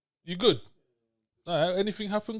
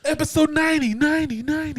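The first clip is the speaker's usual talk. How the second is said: mock reverb echo; 11 semitones higher, 7 dB louder than usual. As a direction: repeat the last word like a fading echo, higher. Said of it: "Man, put the reverb on".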